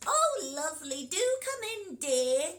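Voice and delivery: wolf pretending to be grandma; croaky granny wolf. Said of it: "croaked the wolf".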